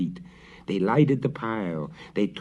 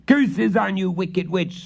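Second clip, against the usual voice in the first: high, loud enraged curse; furious scream; 12 semitones higher, 7 dB louder than usual. A narrator's reading. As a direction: hurl the curse at her. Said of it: "screamed the prior".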